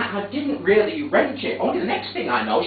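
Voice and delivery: normal talking voice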